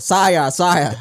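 loud courtly address; town crier. Acted. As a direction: call out like a royal herald. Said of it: "Lord Minister Kenke".

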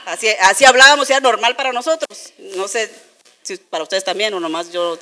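ordinary talk

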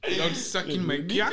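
laughing through a mock movie line; giggling villain line. Finishing the villain quote.

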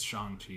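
"Shang-Chi" said SHAHNG-chee; film's pronunciation. Shown as a demonstration. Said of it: "That's how he says it".

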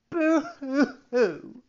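high, loud, drawn-out boo-hoo; slow, hammed-up storybook sobbing; mock crying wail. A narrator's reading. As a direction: wail like a bawling child. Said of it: "began to cry and scream so loudly".

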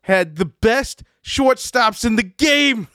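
high, slow mock yell in a New York accent; angry New Yorker. Angry fan yelling in a parking lot, New York accent. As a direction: imitate an irate New Yorker, stretching every word. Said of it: "this is why he's a New Yorker".

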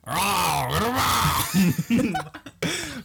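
drawn-out, rising and falling Wookiee growl; Wookiee roar. Acted. Showing friends a Wookiee, playful roar. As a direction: roar out a long Wookiee call. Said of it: "It's a Wookiee".

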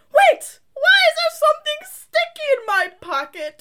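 put-on high little-girl voice, laughing; childlike voice. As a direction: play the childish lady, baffled. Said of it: "she sounded like a child".

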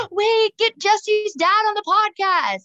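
excited. Real, not acted. Podcaster pitching a guest to co-hosts, real excitement. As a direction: blurt it out high and thrilled. Gasps at a sudden idea.

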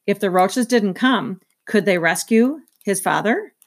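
ordinary delivery, nothing marked

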